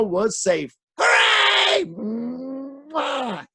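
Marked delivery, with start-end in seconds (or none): 1.0-1.8 cheer. Acted. Cheering at the happy ending. 1.8-3.4 big smacking kiss. Acted.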